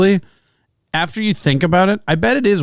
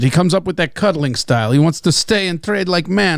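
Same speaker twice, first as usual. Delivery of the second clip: comic Russian fighter griping; Russian accent. Acted. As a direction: complain in a mock Russian accent. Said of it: "you can do a Russian accent".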